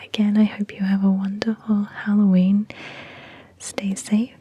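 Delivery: gentle unvoiced whisper throughout; ASMR whisper. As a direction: whisper close to the mic, easy and friendly.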